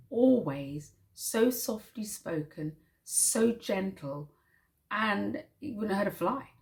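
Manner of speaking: usual speaking voice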